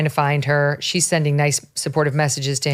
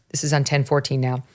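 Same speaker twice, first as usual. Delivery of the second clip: usual speaking voice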